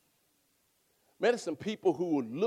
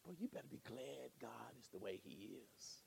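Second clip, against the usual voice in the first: whispered aside; confiding whisper; 7 semitones lower, 24 dB quieter than usual. Real, not acted. Preacher to congregation, secret whisper. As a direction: lean in and whisper it. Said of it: "that's what I'm saying in the confidence".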